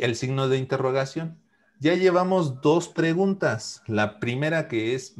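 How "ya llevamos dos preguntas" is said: ordinary talk, nothing marked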